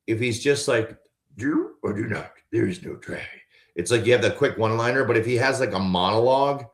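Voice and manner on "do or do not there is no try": a light Yoda voice, raspy; raspy, backwards little sage. Shown as a demonstration.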